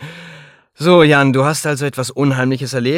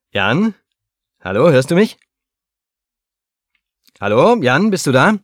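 calling out; 6 semitones higher than usual. Real, not acted. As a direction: raise the voice to reach a bad line. Calling into a bad phone line.

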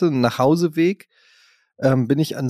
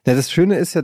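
ordinary talk

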